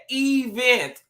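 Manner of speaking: "event" with a long, drawn-out E, high-pitched; stretched first E. Shown as a demonstration. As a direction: draw out the E in "event", then land on vent. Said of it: "put a couple E's in there".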